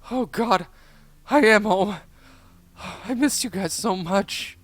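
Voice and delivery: high, loud cry of joy; overjoyed and relieved to be home; happy cry. A narrator's reading. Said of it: "let out a happy cry".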